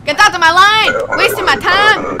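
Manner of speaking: mock angry shout. Inspector ordering a traveller out of line, mock angry shout. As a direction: send them packing with hammed-up annoyance.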